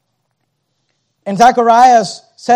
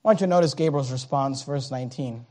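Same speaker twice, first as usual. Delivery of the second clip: ordinary talk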